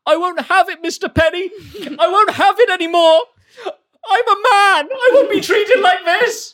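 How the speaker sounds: high, loud, near-crying outburst; rebelling guard. Acted. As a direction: break down and quit in a hammy sob.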